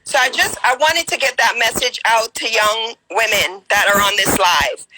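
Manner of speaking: ordinary talk, nothing marked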